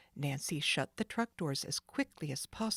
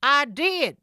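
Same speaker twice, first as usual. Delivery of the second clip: loud, higher protest; defensive thug; 9 semitones higher, 12 dB louder than usual. Acted. Jake defending himself to Sid, defensive. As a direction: insist you did it.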